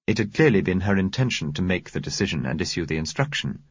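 ordinary delivery, nothing marked

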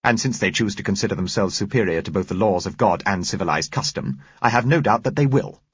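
stony, emphatic pronouncement. A narrator's reading. Woman condemning those who left the community, stony. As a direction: close the subject with stony disapproval. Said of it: "She replied stonily".

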